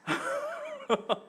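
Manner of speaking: a sudden high-pitched giggle; high laugh. Real, not acted. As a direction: laugh in embarrassment. Laughs at his own confession.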